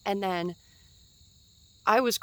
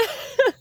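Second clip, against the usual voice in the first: high burst of laughter; 9 dB louder than usual. Real, not acted. Laughs at her own confusion.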